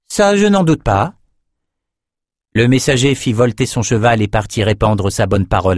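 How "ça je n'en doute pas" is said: higher, cool sorceress voice; a proud sorceress, pitched up; Nicci character voice. Acted. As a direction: speak down to the messenger, icy and sure. Nicci's dry retort.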